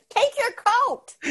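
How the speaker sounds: whiny voice. Retold or imitated. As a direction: nag it in a high whine. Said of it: "that whiny voice".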